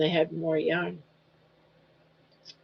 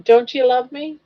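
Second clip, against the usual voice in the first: higher, louder pretend plea to a pet; mock pleading; 5 semitones higher, 5 dB louder than usual. Owner begging her dog for love, higher and louder mock plea. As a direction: beg the dog, hamming up the hurt.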